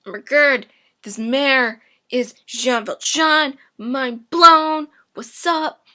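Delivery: high, loud hammed-up shock; mock realization. Javert realizing the mayor is Valjean, loud mock shock. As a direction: gasp out the discovery, loud and high. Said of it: "And then he realizes".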